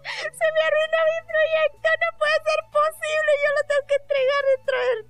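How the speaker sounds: mock sobbing. To her brother, a retold high wailing sob. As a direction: wail it like a lost cause.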